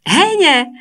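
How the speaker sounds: sharp high shouted word. A narrator's reading. Teacher shouting at the frozen class, a high loud cry. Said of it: "kiáltott Hormici".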